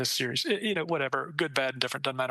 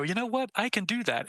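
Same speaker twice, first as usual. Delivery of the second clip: excited realization; 7 semitones higher than usual. Retold or imitated. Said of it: "watch terrible movies and go".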